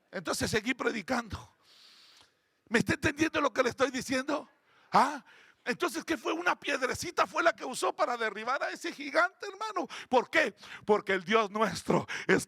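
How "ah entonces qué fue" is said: ordinary talk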